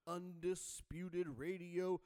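booming ringmaster intro. Acted. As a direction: announce it like a circus ringmaster. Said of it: "such the, like, nineties late night guy".

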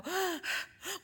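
a high startled gasp; sharp gasp. Retold or imitated. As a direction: gasp as it suddenly hits you. Sudden realisation at table.

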